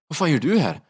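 high-pitched, astonished question; startled demand. Retold or imitated. Man opens door to unexpected rider, startled. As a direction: act out being caught off guard at the door.

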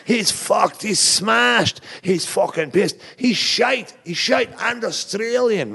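high-pitched mock Scottish heckles; hammed-up heckler calls, pitched right up; imitated heckling yells. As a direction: imitate a crowd jeering in Scottish accents. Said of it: "people started yelling".

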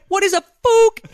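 put-on high, squeaky kid's question; imitating amused child. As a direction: pitch it way up like a little boy. Mimicking son's amused question.

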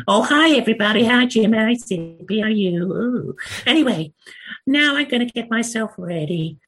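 very high, squeaky beaver greeting everyone; beaver puppet voice. Acted. Puppeteer re-enacting the audition sketch for Jim, squeaky beaver voice. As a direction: pipe it up like a puppet beaver with bad American accent. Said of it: "doing my dreadful American accent".

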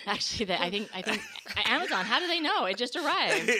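loud laughing talk. Real, not acted. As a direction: laugh through the words. Laughing at remark about voice.

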